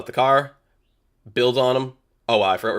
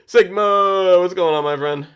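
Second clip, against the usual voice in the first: excited greeting; 8 semitones higher, 7 dB louder, slower than usual. Real, not acted. Greeting a viewer by name.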